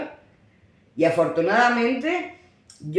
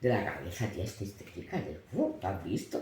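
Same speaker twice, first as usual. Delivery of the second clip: much softer, lower gossiping murmur; gossiping whisper; 12 semitones lower, 8 dB quieter than usual. Retold or imitated. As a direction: whisper it behind her back. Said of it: "entre ellas se miran y dicen". (cuchicheo de las otras madres, muy bajo y cómplice)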